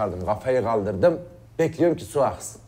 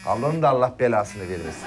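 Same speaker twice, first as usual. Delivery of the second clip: normal talking voice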